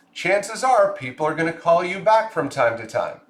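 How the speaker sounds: ordinary talk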